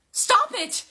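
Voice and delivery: high, loud shout; panicked full-voiced yell; desperate scream. A narrator's reading. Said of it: "Piper screamed again".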